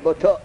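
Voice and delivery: بطو as "bo-to", ending in o; damma on ta. Shown as a demonstration. Teacher repeating the result of damma, بطو said "bo-to". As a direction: say بطو with an o after the t. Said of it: "ضمه دادیم".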